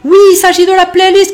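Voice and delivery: hype. Real, not acted. Hyped intro aside.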